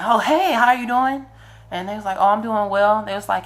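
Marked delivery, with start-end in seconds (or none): 0.0-1.3 much higher, louder eager hello; surprised cheerful greeting. Retold or imitated. Answering Disney call, voicing himself. 2.2-3.0 friendly caller. Retold or imitated. Voicing the Disney caller.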